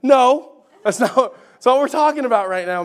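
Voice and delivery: exasperated but laughing; laughing protest. Retold or imitated. Dad laughing as his son deflects. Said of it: "I'm like".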